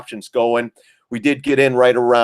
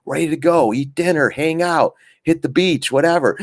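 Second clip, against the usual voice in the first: higher-pitched, coaxing parent; reasoning dad; 6 semitones higher than usual. Acted. Dad talking daughter round about the trip, higher-pitched coaxing. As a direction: lay out the plan to an upset child. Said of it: "I'm like, "Honey".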